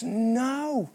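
reassuring local barman, voice lifted; barman's reply. Retold or imitated. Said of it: "the barman looks at me and says".

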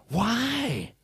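high, drawn-out falling WHY; demanding Pilate. Acted. Preacher as Pilate challenges the crowd, drawn-out demand. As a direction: cry out a long, protesting why. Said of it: "Pilate demanded".

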